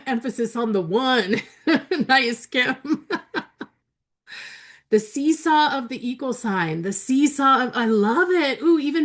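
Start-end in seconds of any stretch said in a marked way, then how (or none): 3.1-3.6 high giggle. Real, not acted. Reading funny chat comment.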